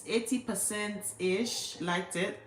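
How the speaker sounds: normal talking voice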